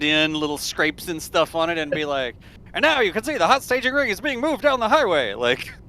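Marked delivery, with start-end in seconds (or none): 2.7-5.3 put-on high old newsreel announcer; transatlantic announcer voice. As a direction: narrate like a vintage newsreel. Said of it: "the transatlantic announcer voice".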